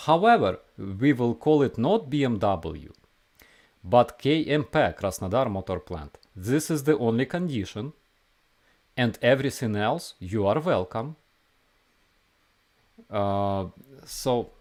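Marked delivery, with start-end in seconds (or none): 0.1-11.2 put-on Russian accent. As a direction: voice an old Russian grandma. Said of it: "I will read it for you in a Russian accent".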